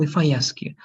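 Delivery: usual speaking voice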